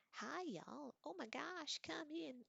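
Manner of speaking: high, sing-song Oklahoma twang; perky Okie accent. A narrator's reading. Roommate welcoming guests at the door, perky Okie accent. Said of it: "called a perky voice in an Oki accent".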